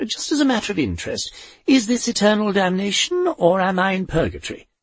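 put-on brisk high old lady asking; old woman asking Death where she is, brisk and high; Poldy, curious. A narrator's reading.

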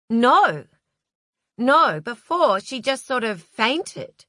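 slowly insist, appalled at the sight. A narrator's reading. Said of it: "watched with appalled fascination".

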